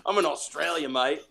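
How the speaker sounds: hammed-up Aussie; Australian accent. Joker declaring himself Australian, mock Australian accent. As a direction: put on a joking Australian accent. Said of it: "you pulling out the accents".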